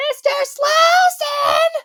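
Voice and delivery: high, loud calling out; imitated scream. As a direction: scream for him like a scared film heroine. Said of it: "She's, like, screaming".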